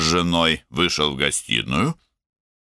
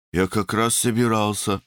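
higher-pitched defensive reply; 6 semitones higher than usual. A narrator's reading. Younger brother backing to the door, defensive. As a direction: excuse yourself while giving way.

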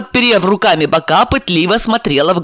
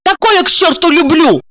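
raging; 12 semitones higher, 4 dB louder than usual. Acted. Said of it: "бушевала Марина".

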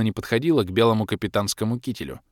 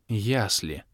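gloomy; 2 semitones lower, 6 dB quieter than usual. A narrator's reading. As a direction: say "Ясли" darkly, resigned. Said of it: "мрачно выговорила баронесса".

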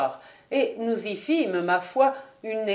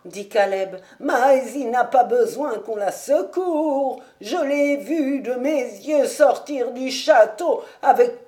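put-on flustered old servant; 3 semitones higher, 7 dB louder than usual. Caleb caught lying to his master, loud flustered old servant. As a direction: bluster through being caught out.

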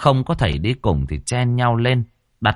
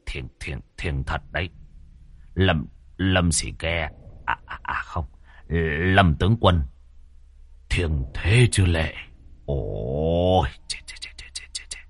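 low, hushed, halting mumble; stunned muttering; 6 semitones lower, 5 dB quieter than usual. Acted. Ritual master stunned by the sacred wind, muttering. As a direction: mumble to yourself, dwindling into a whispered stutter. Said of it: "ông lẩm bẩm".

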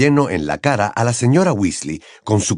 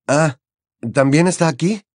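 usual speaking voice

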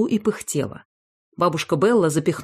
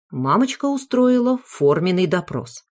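ordinary delivery, nothing marked

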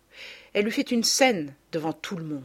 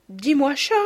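a touch of tremble, higher; slightly trembling voice; 7 semitones higher, 4 dB louder than usual. A narrator's reading. Mother to father alone at night, slightly trembling tender voice. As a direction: coax him tenderly. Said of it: "d'une voix un peu tremblante".

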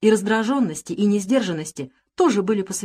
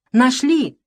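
high, loud cry of discovery; excited messenger crying out; breathless shout; 7 semitones higher, 6 dB louder, much slower than usual. A narrator's reading. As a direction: burst in shouting the news. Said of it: "задыхаясь, кричал он ещё на пороге".

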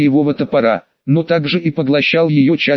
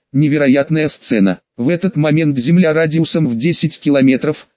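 usual speaking voice

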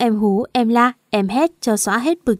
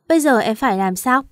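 ordinary delivery, nothing marked

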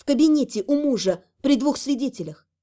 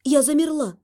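usual speaking voice

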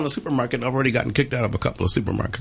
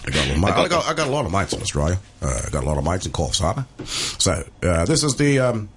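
put-on low-pitched Australian accent; 8 semitones lower than usual. Guide leads a mate toward the tiger, low Australian voice. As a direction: play an Australian wildlife guide, pitched well down. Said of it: "That's my Australian voice".

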